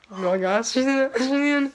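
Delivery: high, loud, excited cheering; excited shout. Real, not acted. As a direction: yell it out in delight. Sister gets into ice bath.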